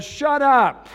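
high, loud mocking crowd; fickle crowd. Pastor mocking the crowd's fickle response, loud. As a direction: bark it then flip to cheer. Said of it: "Their response in their flesh was".

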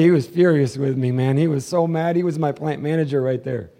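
ordinary delivery

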